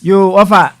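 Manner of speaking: loud call to cut in; host shouting over the caller; calling out loudly. Real, not acted. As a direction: raise the voice to get his attention. Host louder, tells caller calm down.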